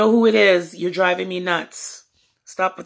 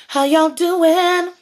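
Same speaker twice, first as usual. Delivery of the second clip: loud greeting; 8 semitones higher, 6 dB louder, slower than usual. Real, not acted.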